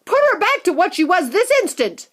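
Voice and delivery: high, loud furious demand; demanding mother. Acted. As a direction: order Wonka to fix it.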